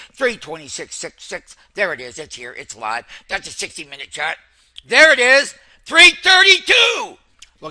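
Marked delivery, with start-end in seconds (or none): 4.9-7.2 much higher, loud shout of the price; excited shout. Real, not acted. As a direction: yell the number as the stock rips. Stock spikes, trader shouts price.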